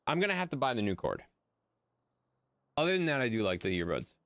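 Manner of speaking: usual speaking voice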